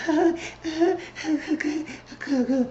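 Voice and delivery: very high imitated squeals; high-pitched mock giggly noises; mock squealing noises. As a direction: squeal in little bursts, mocking their glee. Imitating the happy group's reaction.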